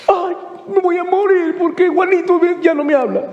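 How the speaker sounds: high, hammed-up heartbreak whine; mock lovesick wailing. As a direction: wail melodramatically, mocking a jilted lover. Mimicking lovesick teenager's breakup drama.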